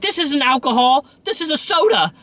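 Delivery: put-on high, indignant drunk protest; a hint of a slurring drunk denying it; drunk woman. Drunk passenger protesting to the bus driver. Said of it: "and she's like, as drunk".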